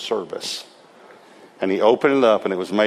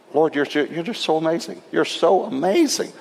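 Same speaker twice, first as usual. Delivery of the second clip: rising, hushed marveling at God; awed praise; 6 semitones higher than usual. Retold or imitated. Preacher marveling at God after the offering. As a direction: breathe out amazement at the Lord.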